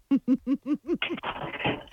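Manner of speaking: soft, amused giggle; soft chuckle. Real, not acted. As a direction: chuckle softly to yourself. Amused at own pronunciation.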